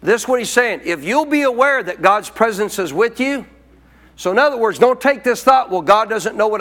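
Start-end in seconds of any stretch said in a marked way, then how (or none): none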